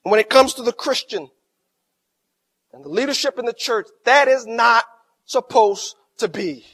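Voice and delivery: loud, emphatic pulpit delivery; forceful preaching. Acted. Preacher warning the congregation, loud and emphatic. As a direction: hammer the point home to the church. Preacher driving home a point.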